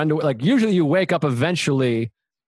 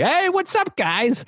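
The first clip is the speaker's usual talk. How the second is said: high-pitched mock-friendly hello; playing the sociable guy; outgoing greeting; 12 semitones higher, slower than usual. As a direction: sing out a chummy greeting.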